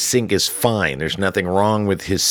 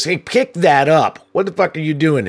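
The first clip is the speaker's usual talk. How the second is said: angry scolding; 7 semitones higher, 3 dB louder than usual. Retold or imitated. Supervisor yelling at the guy who littered, angry. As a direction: bark the order at the litterer.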